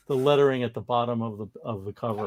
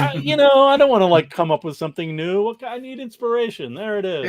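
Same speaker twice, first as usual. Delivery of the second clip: excited geeky rush; 8 semitones higher, 7 dB louder than usual. Real, not acted. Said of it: "Talk about geeky".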